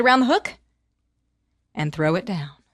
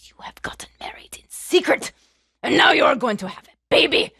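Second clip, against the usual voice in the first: whisper building to shout; 3 semitones higher, 5 dB louder than usual. Acted. Sorceress finding the secret marriage, hush to shout. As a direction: start hushed then explode.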